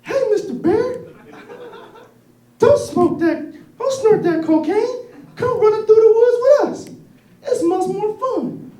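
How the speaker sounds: squeaky little rabbit voice. Acted. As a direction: chipper high-pitched bunny. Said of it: "Rabbit says".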